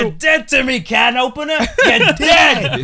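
high, loud cartoon yell; cartoon character shout.

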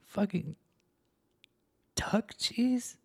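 unvoiced, high whisper of disgust; horrified whisper. Real, not acted. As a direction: breathe it out, appalled.

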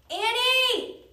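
high, loud desperate shout. A narrator's reading. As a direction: shout into the dark. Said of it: "he shouted".

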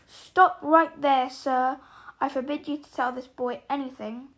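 loud, forceful order, easing slightly at the end; commanding. A narrator's reading. Uncle forbids the giant to tell the boy, commanding. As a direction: forbid it with full authority. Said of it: "he commanded".

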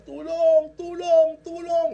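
very high, loud drawn-out cries; screaming for help. Retold or imitated. Man hanging from a branch, screaming for help. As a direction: yell for rescue, stretching each cry. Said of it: "Sigaw siya ng sigaw".

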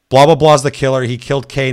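loud reenacted warning to the group; retold urgent alarm. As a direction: burst in and announce the killer loudly. Retold alarm after running back.

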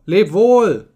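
high, loud farewell call; calling out in chorus. A narrator's reading. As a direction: call goodbye as a whole crowd would. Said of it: "riefen sie im Chor".

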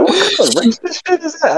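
high, quick, incredulous mock squeal; retold outburst. Dad who thought it was over, high incredulous outburst. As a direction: replay the shock fast, pitch shooting up. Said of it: "I just said it like that".

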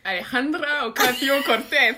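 a hint of Spanish accent, slow and careful on the name. Shown as a demonstration. Co-host asked to say the guest's name in her best accent, lightly Spanish. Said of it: "avec mon plus bel accent".

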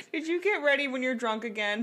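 giggling through the question; laughing. Real, not acted. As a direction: laugh while asking it. Laughing while asking.

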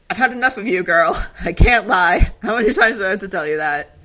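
hammed-up fed-up mentor scolding the girl; exasperated outburst. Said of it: "And Moiraine is like".

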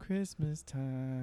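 slow hushed singsong echo; dreamy echo. Acted. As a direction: echo it softly and slowly. Echoing festive line.

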